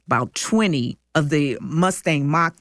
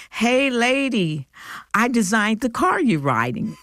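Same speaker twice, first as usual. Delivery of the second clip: retold excited yell; 8 semitones higher than usual. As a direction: re-enact calling out excitedly to a stranger. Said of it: "I was yelling out".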